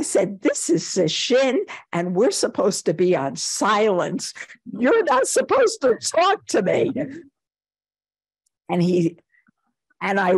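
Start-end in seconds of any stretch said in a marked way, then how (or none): 0.4-6.9 high-pitched indignant scolding, retold; outraged. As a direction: re-enact a self-righteous telling-off. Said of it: "I was just outraged".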